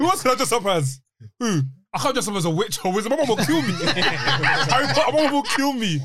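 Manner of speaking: high, laughing disbelief. Real, not acted. Laughing recall, pitched high.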